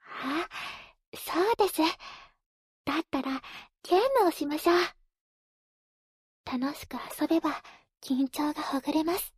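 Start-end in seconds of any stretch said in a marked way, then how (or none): 3.8-4.8 excited. Acted. Sudden idea, bright.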